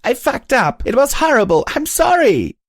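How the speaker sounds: high tearful wail; crying. A narrator's reading. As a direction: wail it out through tears. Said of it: "bursting into tears".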